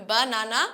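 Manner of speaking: BANANA said evenly with full a in each syllable; laugh, then say banana as baa-naa-naa; unreduced vowels in banana, after a chuckle; wrong full vowels. Shown as a demonstration. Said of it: "না, okay?".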